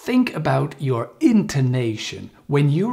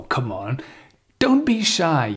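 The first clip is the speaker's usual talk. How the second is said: ordinary talk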